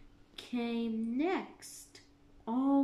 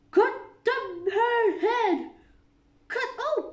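furious shout; 11 semitones higher, 8 dB louder than usual. A narrator's reading. Queen orders Alice's head off, shouting. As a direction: scream the order. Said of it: "She looked at Alice and shouted".

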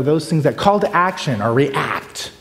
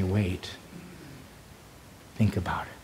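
much softer, lower, unhurried; soft wait; 5 semitones lower, 14 dB quieter than usual. Shown as a demonstration. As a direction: hush them into thinking. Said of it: "am I just wanting to say".

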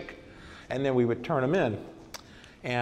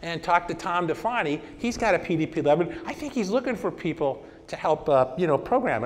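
higher, light helpful voice for the consultant; lightly voiced kid; 6 semitones higher than usual. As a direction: play the eager young helper, a bit higher. Consultant kid's helpful reply.

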